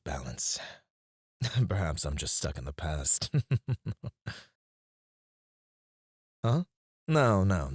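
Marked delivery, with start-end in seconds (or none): 3.4-4.3 rippling chuckle, higher than speech; self-mocking chuckle. Boss admitting he's stuck in the past, chuckling. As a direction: chuckle at your own expense.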